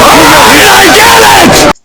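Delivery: high, slow, shouted mock customer voice; hammed-up impression of a customer. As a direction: play the pushy customer, big and drawn out. Customer voiced in retold story.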